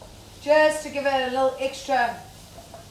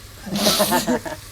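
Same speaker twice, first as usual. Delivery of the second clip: real burst of laughter; amused laugh; 3 semitones lower than usual. Real, not acted. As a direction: laugh at a friend's tiny effort. Amused by companion's one-word Italian.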